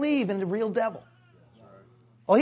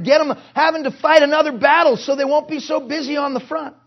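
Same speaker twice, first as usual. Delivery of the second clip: devil as strategist; 4 semitones higher, 4 dB louder than usual. Retold or imitated. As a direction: imitate a commander rallying troops, higher and forceful. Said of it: "when he starts taking a whooping ... he moves his troops".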